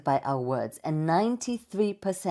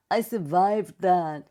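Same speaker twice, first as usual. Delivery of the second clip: slow, drawn-out, higher voice; relieved survivor; 4 semitones higher, 4 dB louder, slower than usual. Acted. Speaker voicing the thought after a scary talk, slow relief. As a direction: stretch it out as a barely-made-it thought. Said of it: "you stop thinking".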